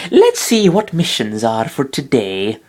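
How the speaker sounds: slow, strange put-on girl voice; a weird overdone cutesy voice; odd Chikorita voice. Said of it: "I don't know what the fuck that was".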